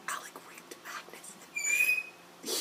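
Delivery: slow, hushed whisper; barely voiced, drawn-out whisper; appalled whisper. Real, not acted. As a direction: whisper it slowly, as if unspeakable. Reluctant to voice awful rumour.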